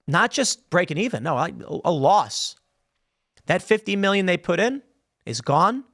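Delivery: ordinary talk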